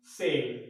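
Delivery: सेल with a soft s sound, said low; सेल spoken as a teaching example, c read as s; demo word cell. Shown as a demonstration. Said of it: "क्या बोलेंगे इसको हम".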